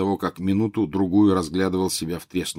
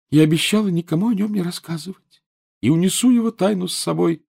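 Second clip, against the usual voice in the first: put-on secretive ghost girl; 11 semitones higher, 3 dB louder than usual. Myrtle voiced by narrator.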